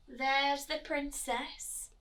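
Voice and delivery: plain reading voice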